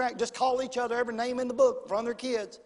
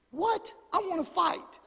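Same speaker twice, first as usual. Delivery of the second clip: high-pitched disbelieving protest; comic, thwarted anger; mock indignant husband; 7 semitones higher than usual. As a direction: play exasperated, pitch jumping up. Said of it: "I'm ticked off".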